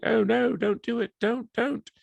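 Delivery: very high, whiny mock pleading; pretend victim. Palpatine fakes being attacked while the recorder runs, pleading very high. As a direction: squeal like a fake helpless victim. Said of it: "vocalize as if he's a victim".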